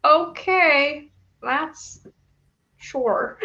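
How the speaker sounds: sarcastic okay sure. Retold or imitated. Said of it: "I was like".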